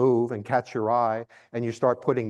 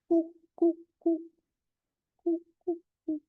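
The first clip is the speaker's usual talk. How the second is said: cuckoo coo call; faster than usual. Shown as a demonstration. Birder replaying the mystery call for guessers, high coo. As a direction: pipe the bird's coo again. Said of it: "If you had to put words to it".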